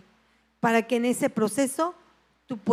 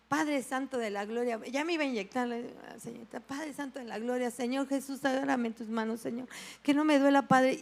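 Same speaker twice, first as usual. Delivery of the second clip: hushed, pleading under the breath; frightened prayer; 6 dB quieter than usual. Retold or imitated. Patient praying to God as the needle comes, hushed and fearful. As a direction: pray softly that it won't hurt.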